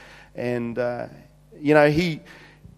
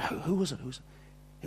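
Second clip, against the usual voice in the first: dropping to a whisper on the repeat; softer, breathless asking who; hushed asking; 2 semitones higher, 13 dB quieter than usual. Retold or imitated. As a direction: lean in and ask softly. Eager to hear the secret.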